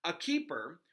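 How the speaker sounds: steady read-aloud storytelling voice; story narration. Acted. Reader narrating a book chapter to her audience, storytelling. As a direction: read the chapter to listeners, even and clear. Reads Harry Potter aloud.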